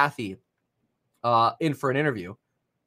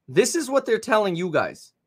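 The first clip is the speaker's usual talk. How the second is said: usual speaking voice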